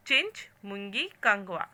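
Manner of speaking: ordinary talk, nothing marked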